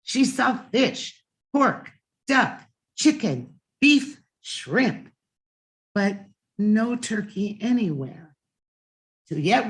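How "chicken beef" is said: usual speaking voice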